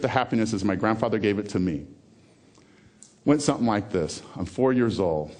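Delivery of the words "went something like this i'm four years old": ordinary talk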